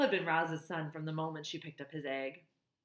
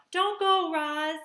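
high, loud pleading cries from the crowd; the island animals begging Roz to stay, loud and high; crying out; 9 semitones higher, 5 dB louder than usual. A narrator's reading. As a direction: call out desperately to stop her leaving. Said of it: "Voices cried out".

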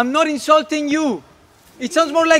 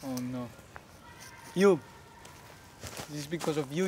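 stunned low murmur; 11 semitones lower, 14 dB quieter than usual. Acted. Hermia stunned, turning on Helena.

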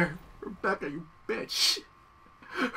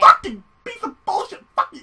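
furious yell; 13 semitones higher, 10 dB louder than usual. Real, not acted. Raging at the game.

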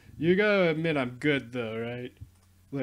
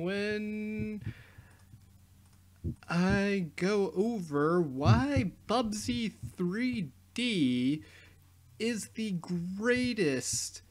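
slow, higher drawn-out hype voice; mock grand announcement; 5 semitones higher, much slower than usual. Youtuber teasing next video in mock hype voice. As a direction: sell the joke like a trailer. Ironic outro praising Bubsy 3D.

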